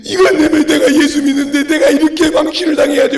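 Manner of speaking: loud mock tearful lament; complaining believer in prayer. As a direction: wail it out as an aggrieved worshipper. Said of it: "하고 아무리 찾아오고 기도해봐".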